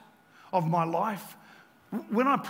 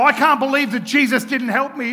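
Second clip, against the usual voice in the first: loud, raised-pitch mock complaint; indignant complaint; 6 semitones higher, 11 dB louder than usual. Preacher mimicking people with a problem, indignant. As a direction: act out someone loudly blaming Jesus. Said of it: "when they have a problem, they go".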